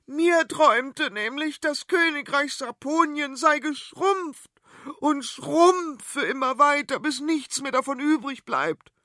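high, loud, dramatic queen; queen warning her family, high and loud; alarmed queen. Acted. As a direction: proclaim doom in a high, loud voice. Queen tells her dream.

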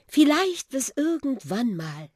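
high, hesitant little car voice; Susi's goodbye. A narrator's reading. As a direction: let the farewell hang, unsure. Susi says goodbye to Buschi.

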